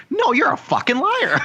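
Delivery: very high, shrieking mock outrage; mock furious accusation. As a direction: ham up a furious girlfriend. Quoting Amber's accusation.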